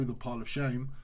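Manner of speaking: usual speaking voice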